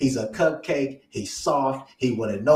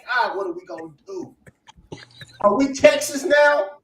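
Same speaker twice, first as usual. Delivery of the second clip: rising to a high, louder mock panic; 8 semitones higher, 6 dB louder than usual. Imitating panicked fans online, a rising mock panic. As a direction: play the frantic fan losing it. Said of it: "It's like".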